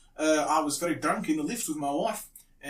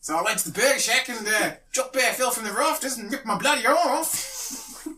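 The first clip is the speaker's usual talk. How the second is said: put-on raised-pitch Australian bloke accent; 8 semitones higher, 3 dB louder than usual. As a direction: tell it like an Australian storyteller. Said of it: "I pretend to be an Australian man".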